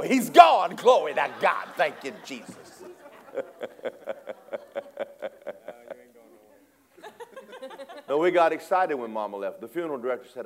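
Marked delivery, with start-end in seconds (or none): 0.0-2.8 louder, cheering mock joy; hammed-up praise at his death; gleeful shout turning slow; mock celebration. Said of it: "some of y'all will be happy". 2.9-6.0 breathy laugh. Real, not acted. Preacher laughs.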